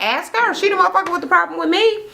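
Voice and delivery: high, fast indignant retort; high mock-indignant retort; high fed-up snap. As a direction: throw it back at her. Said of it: "And she was like".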